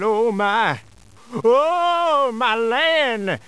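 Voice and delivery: shivering fear. Acted. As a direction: quake with fright. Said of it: "You must begin to shiver violently now".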